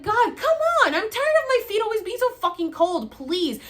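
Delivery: high, loud exasperated outburst; yelling. Retold or imitated. Patient fed up with always-cold feet, acted exasperated yelling. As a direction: re-enact a fed-up yell, easing off on the plea. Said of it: "And I'm, like, yelling".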